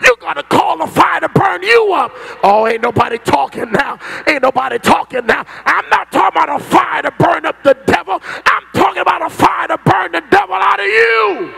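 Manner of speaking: shouting, high-pitched preacher crying out for fire; fiery preaching. Acted. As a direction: preach it full force, calling down fire. Preacher rising again.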